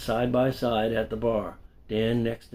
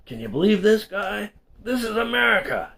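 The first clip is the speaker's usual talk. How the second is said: much higher and louder, disbelieving; mock outrage, rising in disbelief; incredulous; 11 semitones higher, 5 dB louder than usual. Dan appealing to the bartender, loud incredulous mock outrage. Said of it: "he said in an incredulous tone".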